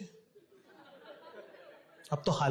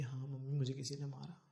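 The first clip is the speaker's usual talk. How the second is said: put-on much softer, lower little-boy admission; boy finally admitting to his mother he was beaten, soft; small confession; 3 semitones lower, 12 dB quieter than usual. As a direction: confess it meekly.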